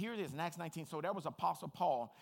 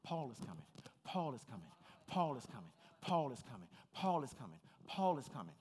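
repeated lower, softer alarm; worried demon chatter; 5 semitones lower, 3 dB quieter than usual. Retold or imitated. Demons warning each other, low and hushed. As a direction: demons passing the warning along. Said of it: "They tell each other".

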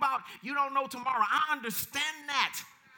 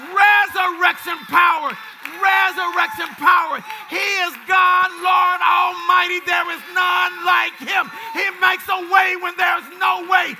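high, shouted declarations in driving bursts; full-force preaching; 5 semitones higher, 13 dB louder than usual. Real, not acted. Preacher proclaiming resurrection power to the congregation, impassioned shouting. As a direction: preach at the top of your voice, punching every phrase.

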